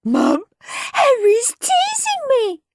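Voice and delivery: slow, high tattletale wail. A narrator's reading. Peter telling Mum about the teasing, wailing. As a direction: whine it high and drawn out. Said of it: "wailed Peter".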